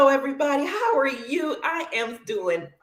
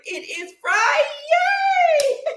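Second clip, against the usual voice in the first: high, slow, laughing shout of Friday; excited shout; 3 dB louder, much slower than usual. Real, not acted. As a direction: stretch it out with glee.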